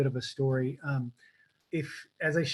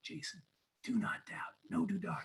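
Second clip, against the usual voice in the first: hushed whisper into someone's ear; imitating a mentor's soft whisper; 2 semitones higher, 9 dB quieter than usual. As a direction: whisper it like a secret warning. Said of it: "he whispers in my ear".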